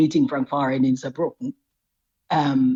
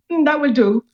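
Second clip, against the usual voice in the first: no emphasis; 10 semitones higher, 5 dB louder than usual. Retold or imitated. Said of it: "No emphasis, no, nothing at all".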